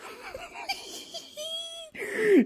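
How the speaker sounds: squealing high-pitched laugh; high giggle. Real, not acted. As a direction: break into a giddy squeal. Laughing about love of life.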